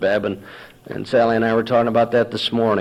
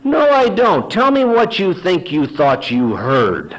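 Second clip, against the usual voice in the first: high opening, slowing into a needling challenge; sarcastic denial; 6 semitones higher, slower than usual. Retold or imitated. Husband baiting his wife, sarcastic mock innocence. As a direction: goad them with exaggerated innocence, dragging it out. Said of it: "go into my routine".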